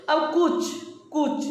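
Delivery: कुछ said slowly and clearly, with a short u; शिक्षक की तरह कुछ का स्पष्ट उच्चारण; pronunciation demo of kuchh. Shown as a demonstration. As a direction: demo कुछ for the students, sounding out the u. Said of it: "अब देखिएगा कुछ बोलते हैं ना".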